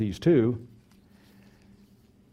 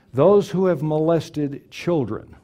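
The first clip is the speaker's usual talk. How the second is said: ordinary talk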